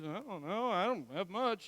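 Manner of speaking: sheepish disciples. Retold or imitated. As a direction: mumble it like an embarrassed disciple emptying his pockets. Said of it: "do one of these".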